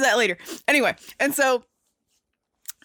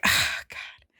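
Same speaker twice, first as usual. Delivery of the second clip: soft voiceless groan of dread; whispered dismay; 5 dB quieter than usual. Retold or imitated. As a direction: breathe it out, dismayed. Villain groans at climber.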